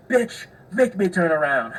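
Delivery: loud, high, slow mock outburst; escalated angry retort. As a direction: imitate someone snapping to full confrontation. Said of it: "jumped up straight to a nine or ten".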